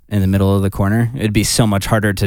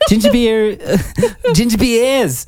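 mock Aussie; 13 semitones higher than usual.

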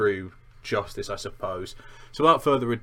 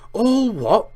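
loud, high incredulous roar; thundered; 12 semitones higher, 9 dB louder than usual. A narrator's reading. Hagrid thundering back at clueless Harry, outraged shout. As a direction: explode in outraged disbelief. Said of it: "Hagrid thundered".

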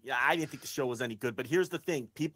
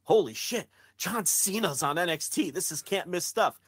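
mock excited fan; 5 semitones higher than usual. Fans thrilled that Cena is on NXT, mock excitement. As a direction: play an overexcited fan.

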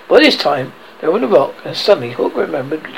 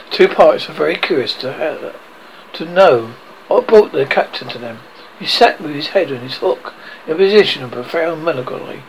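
usual speaking voice

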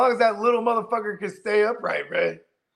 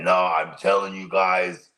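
low, drawn-out put-on voice; slow it right down and drop the pitch, mock-insistent; overconfident claim; 10 semitones lower, 3 dB louder, slower than usual. Acts out an overconfident week-one claim.

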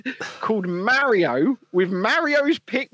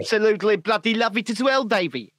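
hammed-up South African accent, higher pitched; 5 semitones higher, faster than usual. Agreeing with a mate in a mock South African accent. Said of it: "I like the bloody South African accent".

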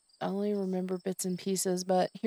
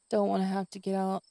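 ordinary talk, nothing marked

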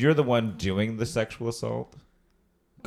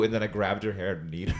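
ordinary talk

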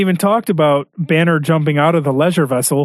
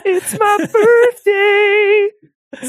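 much higher, very slow Goldblum drawl; Jeff Goldblum impression; 3 dB louder, much slower than usual. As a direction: savor every syllable of "birthday" up high. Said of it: "it's just Jeff Goldblum going".

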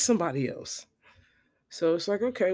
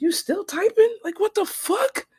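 much higher, louder, slow incredulous squeal; exasperated disbelief; 6 dB louder, slower than usual. Retold or imitated. Teller staring at the typing dots, much higher and slow. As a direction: stretch it out in disbelief. Watching her endless typing.

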